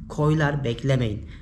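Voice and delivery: ordinary delivery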